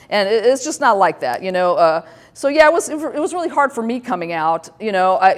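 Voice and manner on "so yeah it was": usual speaking voice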